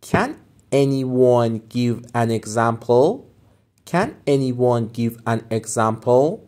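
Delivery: slow, clear rising question; slow model question. Shown as a demonstration. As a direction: ask it slowly for learners. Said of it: "teacher models phrase".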